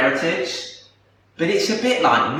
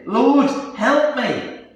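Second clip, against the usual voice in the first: slow, high-pitched plea; pleading; 7 semitones higher, much slower than usual. A narrator's reading. Woman kneeling before Jesus, pleading for help. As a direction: draw it out as a narrated appeal. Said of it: "She came and knelt before him".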